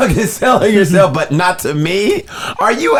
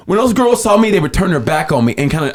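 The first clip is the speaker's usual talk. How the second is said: ordinary talk, nothing marked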